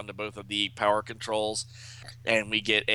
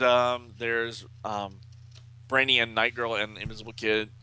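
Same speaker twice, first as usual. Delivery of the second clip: ordinary delivery, nothing marked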